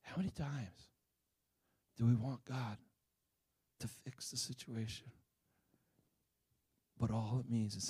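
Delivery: soft, low sermon voice, measured and earnest; quiet preaching. Acted. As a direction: draw the listeners in gently. Pastor shifts into sermon.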